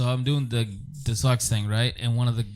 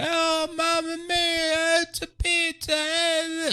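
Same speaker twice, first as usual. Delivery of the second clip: very high, drawn-out mock Italian; over-the-top Italian accent; much slower than usual. Kid on the mic to a stadium, hammed-up Italian. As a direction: stretch every word in a hammed-up Italian wail. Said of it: "He does the whole Italian thing".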